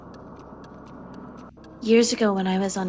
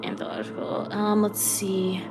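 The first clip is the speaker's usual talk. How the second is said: ordinary delivery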